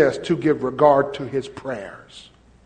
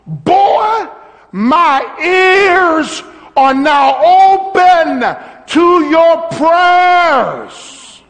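high, loud, slow call of God; God shouting to Abel that he hears him, high and slow; shouted voice of God; 13 semitones higher, 7 dB louder, much slower than usual. Acted. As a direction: boom it out slowly as God calling to Abel. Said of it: "He says to Abel".

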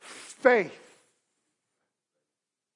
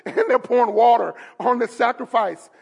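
normal talking voice

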